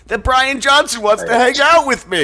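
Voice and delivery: high, loud giddy gushing; giddy squeal. Real, not acted. Friend gushing to the hosts about [name], high giddy squeal. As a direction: squeal it out like a starstruck fan.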